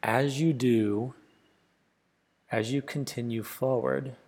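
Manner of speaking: unhurried meditation voice, long pauses between phrases; softly spoken, spacious relaxation guide; guided meditation. Acted. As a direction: guide the listener gently inward, leaving space after each phrase.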